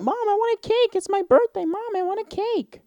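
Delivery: put-on very high pleading child voice; whiny little kid. Kid begging his mom for a birthday cake, very high whine.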